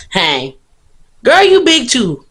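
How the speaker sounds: hammed-up high loud sass; sassy imitation. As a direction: imitate a sassy girl calling out. Mocking girls' attitude.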